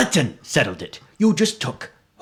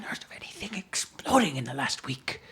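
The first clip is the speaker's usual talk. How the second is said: whispering; 10 dB quieter than usual. Acted. NPC doubts aloud.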